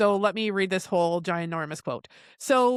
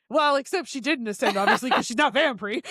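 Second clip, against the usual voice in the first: laughing aside; 5 semitones higher than usual. Retold or imitated. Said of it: "laughing aside on own note".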